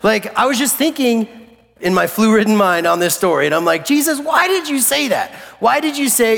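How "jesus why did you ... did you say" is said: high, pleading mock complaint; baffled protest. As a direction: question Jesus in a high, exasperated voice. Baffled preacher questions Jesus.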